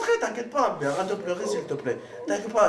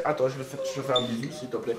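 soft pleading; 8 semitones lower, 5 dB quieter than usual. Real, not acted. Tender plea to crying friend.

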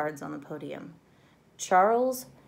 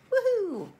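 very high, loud woohoo; excited cheer; 10 dB louder than usual. A narrator's reading. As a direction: whoop with joy. Kid cheering.

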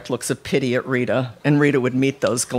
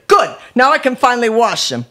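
high, loud shout after someone; yelling mother; 11 semitones higher, 7 dB louder than usual. A narrator's reading. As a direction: yell triumphantly up the stairs. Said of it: "her mother yelling behind her".

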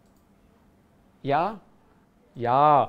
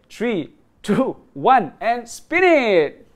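louder, higher drawn-out spin call; hyped giveaway announcement; 6 semitones higher, 5 dB louder, slower than usual. Real, not acted. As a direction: stretch out the last spin. Countdown to final spin.

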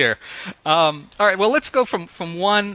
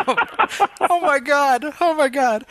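mock shock; 11 semitones higher than usual. Reacting to prank call idea.

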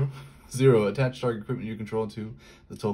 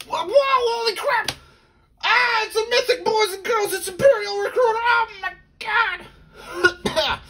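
ecstatic shouting; 8 dB louder than usual. Real, not acted. Pulling a mythic from pack.

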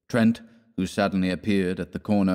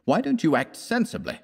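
high, pleading exasperation; exasperated; 9 semitones higher than usual. Acted. Trent scolds his poor friend.

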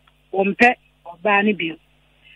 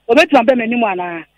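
ordinary delivery

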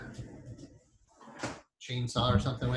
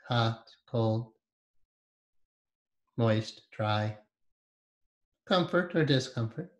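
guided meditation. Acted. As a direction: ease them inward with long pauses.

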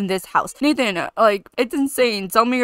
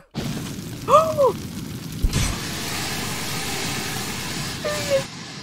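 long, high, airy shocked gasp; 4 dB quieter than usual. Real, not acted. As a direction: gasp in real shock at the fire. Shocked by kitchen fire.

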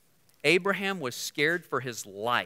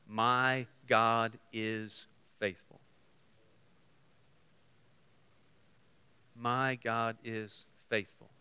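slow declaration; 5 semitones lower, 5 dB quieter, slower than usual. Acted.

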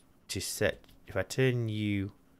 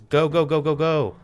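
louder, higher rapid-fire chant; urgent urging; 6 semitones higher, 5 dB louder than usual. Real, not acted. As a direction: urge it on frantically. Urging on in a hurry.

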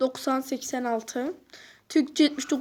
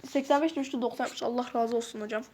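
ordinary talk

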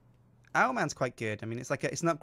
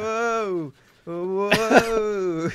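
amazed whoa; 7 semitones higher, 6 dB louder than usual. Real, not acted. Reacting to dizzy owl spin.

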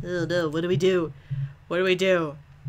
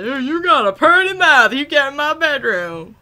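high, loud creepy-suitor caricature; mock your own cheesy pickup line; hammed-up come-on; 6 semitones higher, 7 dB louder than usual. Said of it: "I can't even that I just said that".

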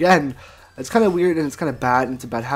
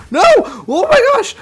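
excited yelp; 8 dB louder than usual. Real, not acted. Sudden close call in fight.

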